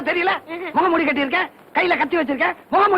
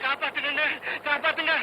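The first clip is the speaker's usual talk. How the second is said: muffled cry from well; 9 dB quieter than usual. Acted. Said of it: "ஏன் கத்துற?".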